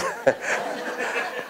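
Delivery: a soft airless laugh at the punchline; breathy laugh. Real, not acted. Preacher laughing at his own story, soft breathy laugh. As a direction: laugh quietly under the breath.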